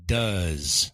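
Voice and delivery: Does said as duhz, rhyming with uh; pronounce does. Shown as a demonstration. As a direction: say Does with the uh vowel. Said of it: "it rhymes with uh".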